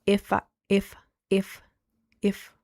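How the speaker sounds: IF repeated with a tiny click on it; short repeated IF, piercing clicking on teeth. Shown as a demonstration. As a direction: tap out each IF so it clicks. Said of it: "do you hear that tiny little click".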